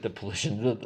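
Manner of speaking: "politician" said as "polition"; slip of the tongue. Real, not acted. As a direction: squash "politician" into three syllables, puh-LISH-un. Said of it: "that's the new word".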